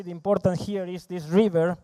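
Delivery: normal talking voice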